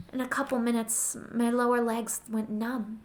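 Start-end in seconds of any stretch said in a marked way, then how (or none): none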